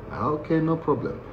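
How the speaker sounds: soft, timid "okay, no problem"; meek agreement. Retold or imitated. New inmate accepting the order to line up, soft meek agreement. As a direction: agree quietly to avoid trouble.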